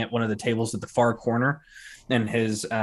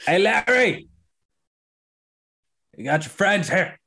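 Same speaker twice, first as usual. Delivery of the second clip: calling out; 8 semitones higher than usual. Acted. Ogre calling Larry through the door, raised call. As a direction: holler for Larry to come out.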